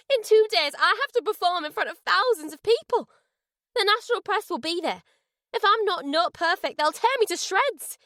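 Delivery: high, frantic outburst about the show; anxious rant. A narrator's reading. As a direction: rant it, panicked and furious. Singer rants about the performance.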